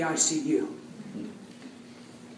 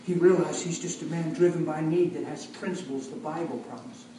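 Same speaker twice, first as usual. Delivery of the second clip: usual speaking voice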